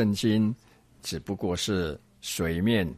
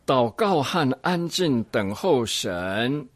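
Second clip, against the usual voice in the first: slow, higher-pitched reading; reading aloud; 4 semitones higher, slower than usual. Acted. Teacher reading a pastor's book to the group, slow deliberate reading. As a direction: read it out deliberately, drawing out the words.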